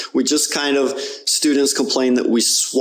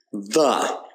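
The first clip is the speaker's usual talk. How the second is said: ordinary delivery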